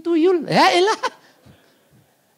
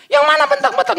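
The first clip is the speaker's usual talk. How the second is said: high, raised-voice retort; bentakan menirukan; 13 semitones higher, 4 dB louder than usual. Retold or imitated. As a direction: protest loudly while denying you shout. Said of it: "Lu kalau ngomong bentak-bentak". (membentak, nada tinggi)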